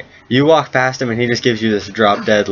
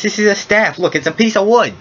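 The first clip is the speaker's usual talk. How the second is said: put-on high-pitched indignant old geezer; worked-up old man scolding a fool; exasperated old man; 10 semitones higher than usual. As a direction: explain it in high, cranky disbelief.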